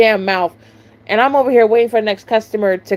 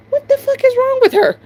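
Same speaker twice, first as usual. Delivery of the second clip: much higher, exasperated outburst; utterly baffled once she's gone; shrieking disbelief. Retold or imitated. Cashier after the staring customer leaves, high shrieking disbelief.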